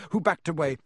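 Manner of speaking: ordinary delivery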